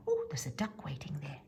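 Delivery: usual speaking voice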